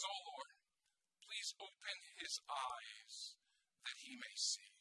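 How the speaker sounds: soft, slow plea to God; hushed prayer. Acted. Elisha praying for his frightened servant, hushed and slow. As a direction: drop to a quiet, unhurried prayer. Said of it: "Elisha prays this ten-word prayer".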